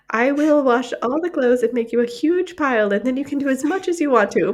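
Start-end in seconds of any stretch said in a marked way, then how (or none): none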